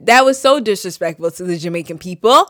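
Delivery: normal talking voice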